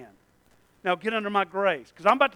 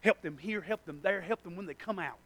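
mumbled prayer; 2 semitones lower, 10 dB quieter than usual. Retold or imitated. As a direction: pray quietly to yourself. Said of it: "mumbling under my breath".

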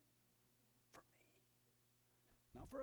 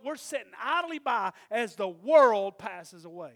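preaching at full force; 6 semitones higher, 11 dB louder than usual. Acted. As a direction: hammer the warning home. Preaching builds.

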